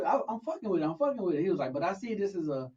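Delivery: usual speaking voice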